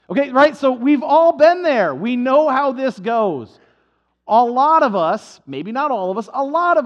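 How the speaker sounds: emphatic preaching. Real, not acted. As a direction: drive the point home to the room. Preacher addressing congregation.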